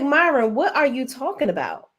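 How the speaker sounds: imitating an incredulous pastor; [name] quoting. Retelling the pastor's reply to Myron, mock exasperated. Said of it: "So he's like".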